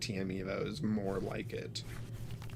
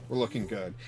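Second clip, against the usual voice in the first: ordinary talk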